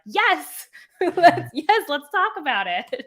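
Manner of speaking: high, loud, excited yes through a laugh; thrilled, high-pitched nerdy outburst; eager fan. Retold or imitated. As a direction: jump on it like an overeager fan. Acting out eager reply to shirt comment.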